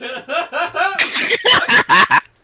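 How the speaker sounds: high burst of laughter; loud laugh. Real, not acted. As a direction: crack up loudly. Reacting to silly power.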